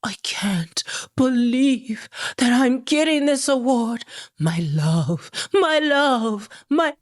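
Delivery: breathy, slow, whispered gushing in mockery; hammed-up whispery disbelief; mock overwhelmed gratitude. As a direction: imitate a star overcome by an award. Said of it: "But now suddenly it is like".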